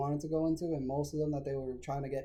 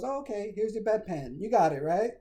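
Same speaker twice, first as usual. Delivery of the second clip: imitated nurse; 6 semitones higher, 4 dB louder than usual. Acting out the dismissive nurse.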